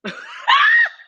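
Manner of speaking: very high, loud cackling laugh; shrieking laugh. Real, not acted. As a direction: let out a piercing cackle. Bursts out laughing.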